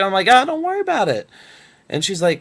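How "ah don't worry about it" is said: breezy reassurance. Retold or imitated. His own breezy reply, retold.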